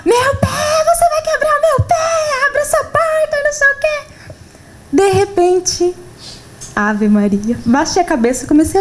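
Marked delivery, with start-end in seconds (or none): 0.0-4.1 grito choroso e desesperado, bem agudo; crying yell. Retold or imitated. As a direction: replay the panicked wail at the bus driver. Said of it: "Comecei a chorar e gritar".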